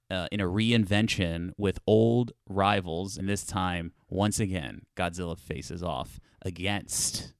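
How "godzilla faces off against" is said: "against" drawn out slowly for emphasis; slow, weighted "against" to close the phrase; stress on "against". Real, not acted. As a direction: lean on "against" and stretch it. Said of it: "emphasis on the against".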